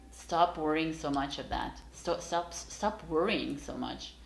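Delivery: ordinary talk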